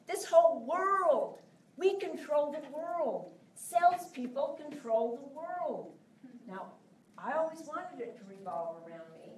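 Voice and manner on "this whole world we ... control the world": booming proclamation. Real, not acted. Proclaiming to audience.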